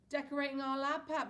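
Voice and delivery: showing off the plan; Dad announcing. A narrator's reading. Dad to son, grandly announcing. Dad's line said twice.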